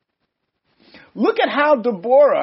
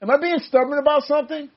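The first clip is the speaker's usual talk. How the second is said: higher, rising questions aimed at God; puzzled self-questioning in a raised pitch; questioning oneself; 5 semitones higher than usual. Acted. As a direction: ask it searchingly, pitched up. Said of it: "I, I kinda gotta ask myself".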